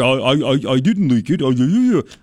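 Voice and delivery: mock Dianne Feinstein. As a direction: mimic a flustered senator caught out, then brushing it off. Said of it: "Dianne Feinstein leaking the story".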